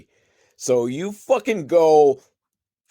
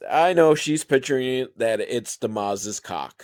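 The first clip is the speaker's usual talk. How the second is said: ordinary delivery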